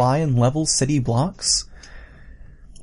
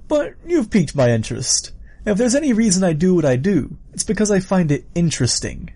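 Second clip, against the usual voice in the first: higher, animated killer voice; intrigued villain; 7 semitones higher than usual. Acted. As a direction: savour your own curiosity as the villain.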